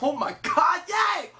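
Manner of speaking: high mock shout of joy; hammed-up, high-pitched celebration; overjoyed fan squeal. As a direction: cheer like an overexcited fan, voice shooting up. Acting out his own excitement.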